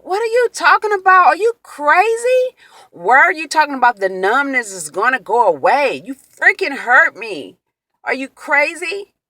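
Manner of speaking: high, indignant retort, loud at the start; heated retold tirade of disbelief; outraged disbelief. Acts out angry retort to husband.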